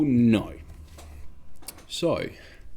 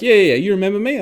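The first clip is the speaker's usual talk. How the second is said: higher, louder excited gushing; eager recognition; 9 semitones higher, 9 dB louder, faster than usual. Retold or imitated. The woman at the pub eagerly asking him, higher and louder. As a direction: bubble over like meeting an old friend.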